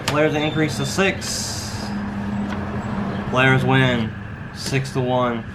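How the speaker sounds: slow, drawn-out dealer call; casino croupier. Acted. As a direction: announce the hand like a baccarat dealer. Dealer calls the baccarat hand.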